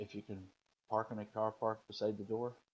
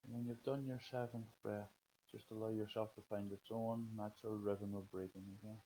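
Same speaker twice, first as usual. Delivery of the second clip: guided meditation. Acted. Leads a breathing meditation.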